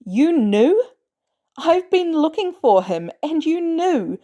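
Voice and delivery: high, loud, hurt accusation; outraged betrayed shout; furious disbelief. A narrator's reading. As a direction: throw it at her in fury. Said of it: "horror and fury".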